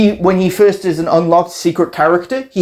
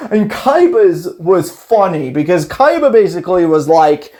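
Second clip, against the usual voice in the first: ordinary talk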